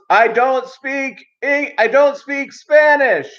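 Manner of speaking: high, slow, loud over-enunciated; mock loud cashier. Cashier shouting slowly at a Spanish speaker, mock loud. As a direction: talk to her like she's deaf. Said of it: "And the guy goes".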